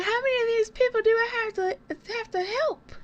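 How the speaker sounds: high, fast, put-upon whine; fed up with helping everyone, pitch it way up; exasperated inner voice. Retold or imitated. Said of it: "I'm like".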